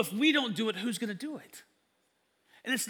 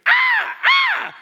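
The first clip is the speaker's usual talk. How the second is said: two sudden high, loud shrieks; piercing double scream; imitated screams; 16 dB louder than usual. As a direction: mimic two startled screams in quick succession. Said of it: "Two screams as his leg pops out".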